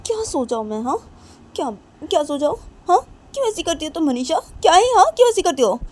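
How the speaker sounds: high, loud, quick indignant outburst; angry shouting back. Acted. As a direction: snap back at her sister. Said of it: "चिल्ला मत".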